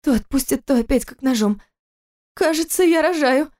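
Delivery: high strained moan. A narrator's reading. Wife in labour moans to husband. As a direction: moan it through the pain. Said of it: "простонала Кира".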